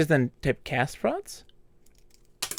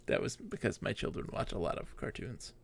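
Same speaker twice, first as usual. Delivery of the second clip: whispered embarrassed aside; sheepish whisper; 6 semitones lower, 17 dB quieter than usual. Real, not acted. Player explaining her outburst, sheepish whisper. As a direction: mutter the excuse under your breath.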